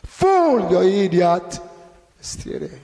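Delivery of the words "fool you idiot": high, loud insult, shouted; sharp rebuke. Shown as a demonstration. Preacher showing the Lord's blunt words to the fool, sharp rebuke. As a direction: yell it like a furious telling-off. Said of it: "firm and sharp rebuke".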